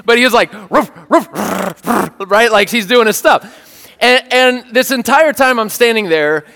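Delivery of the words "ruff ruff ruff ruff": put-on a young dog trying to sound aggressive; puppy bark. Owner corrects his imitation to a riled-up puppy bark. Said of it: "Okay, he's a puppy".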